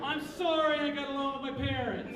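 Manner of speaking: high, very loud comic outburst; mock shouting. Cartoonist defends himself to laughing crowd, mock shouting. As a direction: yell it out in mock indignation.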